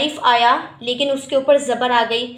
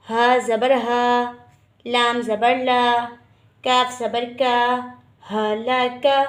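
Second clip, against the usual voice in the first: slow spelling drill; slower than usual. Shown as a demonstration. Teacher spells letters aloud in drill.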